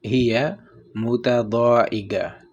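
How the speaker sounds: very slow, with a pause between the words. Shown as a demonstration. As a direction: split it into slow parts. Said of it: "اپنے تلفظ پہ دھیان". (الگ الگ، بہت آہستہ)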